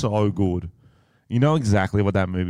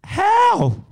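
disbelief; 3 dB louder than usual. Real, not acted. Incredulous reaction.

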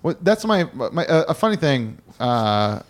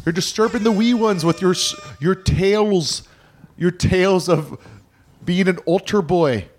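mock Irish scolding. Parent scolding Nail over his tales, mock Irish accent. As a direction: complain like a put-on Irish parent.

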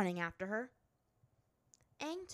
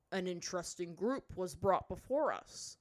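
louder, measured chief's voice; musing High Chief; 6 dB louder than usual. A narrator's reading. High Chief addressing the visitors before him, musing with authority. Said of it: "the High Chief mused".

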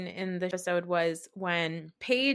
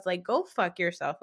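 ordinary talk

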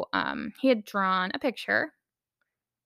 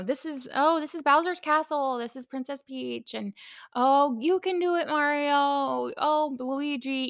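put-on higher excited child narration of the movie; child commentating; 6 semitones higher than usual. Son narrating the Mario movie aloud, imitated higher and eager. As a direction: chirp along like a kid watching Mario. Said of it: "commentator the whole time".

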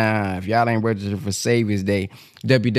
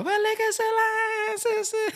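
much higher, sweet greeting to a sister; mocking the greeting; 4 dB quieter than usual. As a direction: overdo a sugary salam. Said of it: "they be trying".

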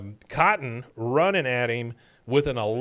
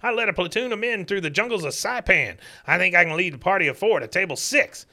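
higher, louder cranky codger; gruff old veteran; 7 semitones higher, 3 dB louder, faster than usual. Retold or imitated. Cotton lecturing the young manager, cranky old veteran voice. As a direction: bark it like an old soldier. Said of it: "He says".

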